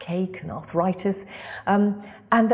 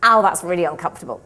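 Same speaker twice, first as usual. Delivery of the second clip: mock complaint; 6 dB louder, faster than usual. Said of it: "we're gonna go".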